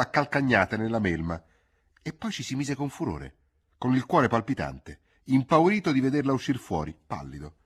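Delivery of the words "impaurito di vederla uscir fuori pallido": plain reading voice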